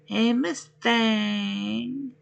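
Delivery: ordinary talk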